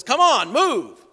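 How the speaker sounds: hammed-up rider losing patience with his donkey; mock angry yell. Balaam whacks donkey and yells.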